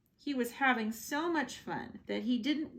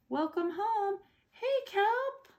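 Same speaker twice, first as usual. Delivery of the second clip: high, bright welcome, much higher; cheery narwhals; 10 semitones higher than usual. Acted. Narwhal friends welcoming Kelp home, high and cheerful. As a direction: greet him like old friends.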